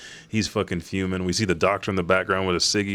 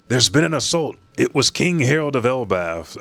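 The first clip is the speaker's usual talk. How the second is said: higher-pitched urgent soldier's report; acted alarm, raised pitch; lightly voiced soldier; 6 semitones higher, 3 dB louder than usual. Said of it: "probably a soldier says".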